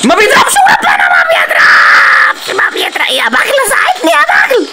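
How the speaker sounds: high whiny frustrated shout. Real, not acted. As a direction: wail that the gun fails. Gun not firing, crying shout.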